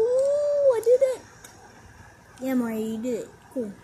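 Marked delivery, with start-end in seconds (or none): 0.0-1.2 high, loud, drawn-out cheer; triumphant cry. Acted. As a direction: crow it out in victory. Celebrates getting the toy through.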